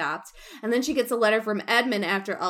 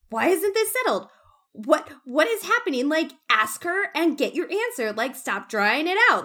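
mock annoyed; 7 semitones higher, 4 dB louder than usual. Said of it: "she's just so annoyed".

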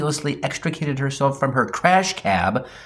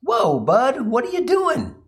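much higher-pitched alarmed objection; startled protest; 11 semitones higher than usual. Acted. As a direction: protest in a high, taken-aback voice. Startled protest while being battered.

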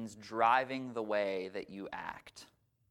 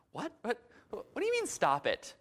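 baffled; 11 semitones higher than usual. Retold or imitated. Woman baffled in skit.

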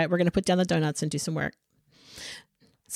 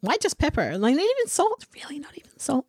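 mock disbelief; 9 semitones higher, faster than usual. Mock outrage over seasoning.

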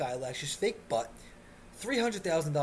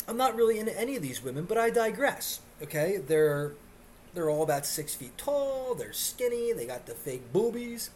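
normal talking voice

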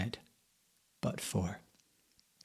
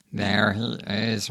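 slow, louder sinister cat; 6 dB louder, slower than usual. A narrator's reading. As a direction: drawl it out like a predator finding prey. One-eyed cat spots him.

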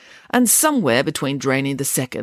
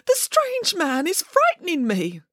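slow, high-pitched fearful plea; frightened urging; 14 semitones higher, slower than usual. A narrator's reading. Boris tells his sister of the strange man, frightened urging. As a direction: plead slowly in a high, scared voice. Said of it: "urged Boris".

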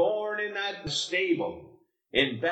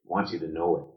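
ordinary talk

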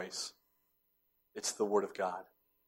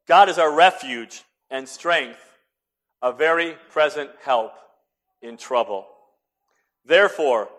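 louder, measured psalm reading; scripture reading; 5 semitones higher, 8 dB louder than usual. Acted. Preacher reciting Psalm forty-six to the church, solemn. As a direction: read it with solemn weight.

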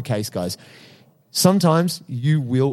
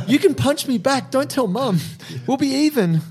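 laughing mock apology; 13 semitones higher than usual. Playful retold apology to Nate.

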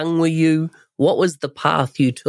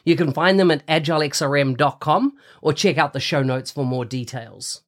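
ad read. Acted. Sponsor ad read on podcast.

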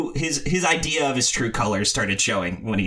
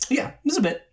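high-pitched breezy denial; mock-innocent, pitched up; gaslighting brush-off. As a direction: dismiss it in a much higher voice. Acts own gaslighting dismissal.

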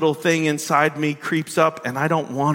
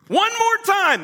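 "One more time" squeaked far higher, mocking; mocking ultimatum; slower than usual. Shown as a demonstration. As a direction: pitch "One more time" way up like a self-righteous warning. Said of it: "That's the way we set it up".

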